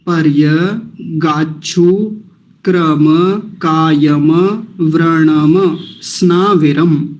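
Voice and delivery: पर्य गाछु क्रम कायम व्रणम स्नाविरम, slowly split; slow recitation. Shown as a demonstration. Teacher reciting the mantra slowly for practice. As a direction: chant the phrase slowly word by word. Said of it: "आराम से देखेंगे".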